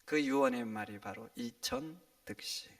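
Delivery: ordinary talk, nothing marked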